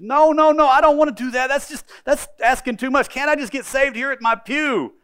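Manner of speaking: high, loud panicked refusal; pleading woman. Retold or imitated. Woman refusing the deacon, high and loud pleading. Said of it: "She said".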